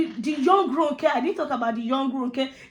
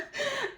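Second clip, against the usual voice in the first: short high laugh; 13 semitones higher, 9 dB quieter than usual. Real, not acted. Laughing about crying over movie.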